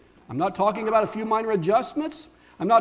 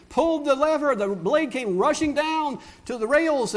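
normal talking voice

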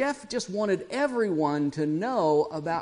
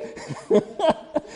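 a burst of high, unvoiced laughter; high airy laugh; 12 semitones higher, 4 dB louder than usual. Real, not acted. Laughing at the branding story.

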